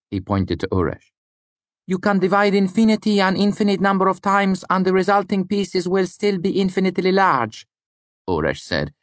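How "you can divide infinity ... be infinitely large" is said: put-on high voice in an odd Lanati accent. A narrator's reading. Student reasoning about infinity, odd Lanati accent. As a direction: explain it in a high, foreign-sounding Lanati accent. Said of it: "in his odd Lanati accent".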